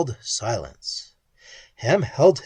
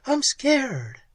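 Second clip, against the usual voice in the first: much higher, frightened whimper; 12 semitones higher than usual. Acted. Pat to Ham, frightened whimper. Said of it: "she whimpered".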